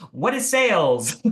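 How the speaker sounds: high, loud laughing panic; flustered laughing. Retold or imitated. Recalling a sales interview mishap, flustered and laughing. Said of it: "I'm like".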